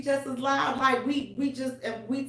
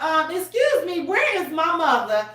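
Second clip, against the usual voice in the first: loud retold demand; 9 semitones higher, 6 dB louder than usual. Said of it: "I'm like".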